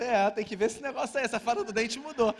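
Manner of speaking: hammed-up complaint about modern tooth fairies; mock indignation. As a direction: play up fake outrage at the fairy. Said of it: "Eu pensando".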